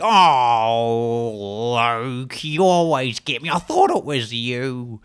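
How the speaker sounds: lightly voiced amused grandmother; a hint of an old lady catching on, slow at the end; imitating nana. Nana realising her grandson pranked her, light mock old lady voice. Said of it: "she's like".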